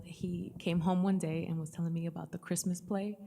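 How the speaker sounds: ordinary delivery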